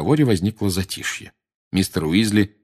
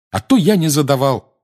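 louder, higher indignant cry; indignant exclamation; 7 semitones higher, 6 dB louder than usual. A narrator's reading. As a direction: protest hotly, feeling left out. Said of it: "негодующе воскликнул Гарри". (возмущённо воскликнуть)

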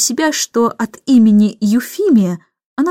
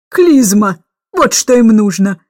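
loud demand; 5 dB louder than usual. Acted. Aunt demands an enema.

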